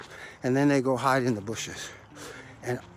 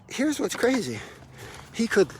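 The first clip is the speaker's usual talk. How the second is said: usual speaking voice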